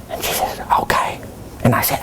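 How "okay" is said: soft unvoiced okay; whisper. Mary accepting the excuse, whispered. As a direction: whisper it back curtly.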